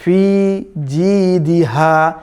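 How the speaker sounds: ফি জি দিহা slowly, firm j and long held vowels; hard jim. Shown as a demonstration. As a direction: chant ফি জি দিহা with a strongly pressed জি. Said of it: "খুবই শক্ত করে উচ্চারণ করতে হবে".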